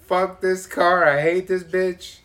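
loud, slow rant at the car; mock rant. Real, not acted. Driver fed up with his rental, loud and fed up. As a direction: vent at the car like it wronged you.

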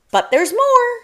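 loud, very high mouse protest; urgent cry. A narrator's reading. Mouse calling back a bear who turned away, loud urgent cry.

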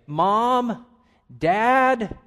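high, loud cry for a parent, each word drawn out; child calling parents. Acted. Son or daughter crying out to mom and dad, urgent call. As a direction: call out like a kid in need. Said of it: "if you heard your son or daughter say".

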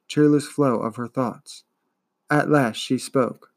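ordinary delivery, nothing marked

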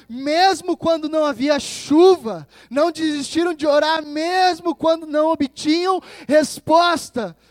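high, loud, driving sermon voice; impassioned preaching. Acted. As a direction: preach it forcefully, punching the key words. Preaches with rising force. (pregação inflamada, em voz alta)

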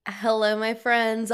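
ordinary delivery

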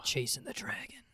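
breathy whispered aside. Acted. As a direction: hush it like a secret.

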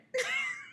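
a high laughing squeal that cracks. Real, not acted. Reliving a funny memory, high squeal of laughter. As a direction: let a giggle shoot up high.

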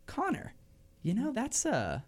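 high, soft old-lady imitation; older woman. Older customer remarks on waiter's name, high and soft. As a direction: muse in a high put-on voice. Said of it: "The older woman would be like".

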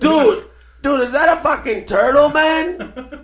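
put-on high-pitched, loud Spanish-accented goofball; mock Spanish accent. Calling Jay over to see a fish, mock Spanish accent. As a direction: play an amazed guy with a Spanish accent, voice way up. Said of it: "you make him sound Spanish".

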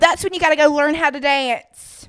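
put-on country accent. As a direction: rant in an over-the-top country accent. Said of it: "I'm trying to get the country accent so hard".

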